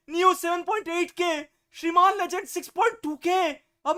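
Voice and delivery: high-pitched, agitated exclaiming; shocked disbelief. Real, not acted. Streamer reacting to rivals' viewer counts, high-pitched disbelief. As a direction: exclaim high, as if your world is shaken.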